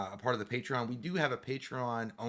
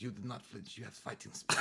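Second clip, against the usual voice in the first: fake Japanese accent; 3 semitones lower, 8 dB quieter than usual. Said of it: "my dad would act like that".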